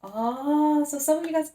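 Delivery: high, loud sudden exclamation; surprised. Real, not acted. Reader startled by a card, surprised and high. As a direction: caught off guard by a card.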